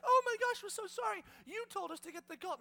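high, panicky, hammed-up apologizing; flustered apology. Disciples after Jesus is thrown, panicked apology. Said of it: "the disciples are like".

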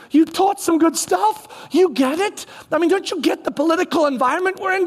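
high, insistent appeal; pleading priests. Retold or imitated. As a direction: beg him to stop it, anxious. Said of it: "they're like".

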